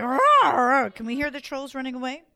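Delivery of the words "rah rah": troll roar. Acted. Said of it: "They were like".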